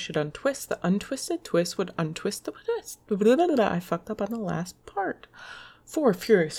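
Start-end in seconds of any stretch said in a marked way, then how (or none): 3.1-3.7 loud blah. Real, not acted. Frustrated blurt after mistake.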